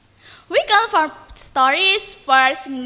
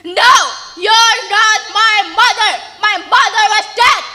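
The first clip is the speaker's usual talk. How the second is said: loud, shouted denial; angry denial; 3 semitones higher, 6 dB louder than usual. Acted. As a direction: bark the denial at her. Malin denies his mother.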